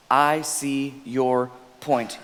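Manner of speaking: I see your point, drawn out slowly; slow, gentle model phrase; slow deliberate demo. Shown as a demonstration. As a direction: lay each word down gently. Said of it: "second part, demonstrated slowly".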